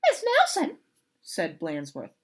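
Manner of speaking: high, fast pompous principal; fussy principal. Acted. Principal telling Miss Swamp of impersonation, high and fast.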